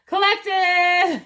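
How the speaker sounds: high, loud, slow cry of recognition; excited call out. Real, not acted. Reader suddenly greeting her collective audience, loud excited call.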